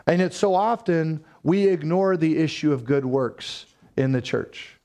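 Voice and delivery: ordinary talk, nothing marked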